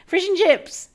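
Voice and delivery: slow, drawn-out, high and loud sales pitch; mock chip-shop sign voice. Mocking North American chip shops, slow loud high sales voice. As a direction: ham up a fake-authentic shop boast. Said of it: "they're like".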